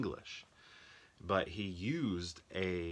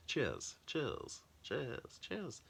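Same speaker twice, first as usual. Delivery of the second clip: mock British cheers; 7 semitones higher, 3 dB quieter, much slower than usual. Shown as a demonstration. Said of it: "don't British people say cheers?".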